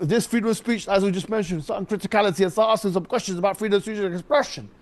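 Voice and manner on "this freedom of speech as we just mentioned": normal talking voice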